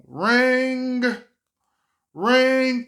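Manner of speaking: loud high imitated phone ringing. A son recalling his mom's call, loud mock ringing.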